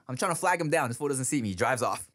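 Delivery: ordinary talk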